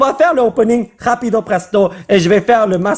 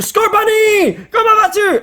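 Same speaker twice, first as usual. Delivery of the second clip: excited greeting; 13 semitones higher, slower than usual. Real, not acted.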